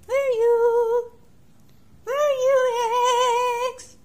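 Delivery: high, loud sing-song calling; calling the cat. Real, not acted. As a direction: call out up high to find the cat.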